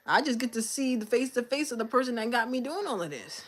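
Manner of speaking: normal talking voice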